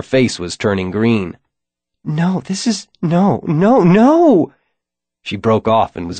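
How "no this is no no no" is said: high, rising panic to a cry; panicked denial. A narrator's reading. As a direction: fall apart, refusing to accept. Sarah panics before being sick.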